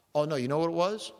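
ordinary talk

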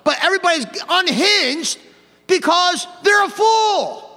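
high, slow, drawn-out shout; emphatic preaching shout. Real, not acted. Preacher declaring why everybody's unhinged, slow high shout. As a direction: punch it out word by word from the pulpit.

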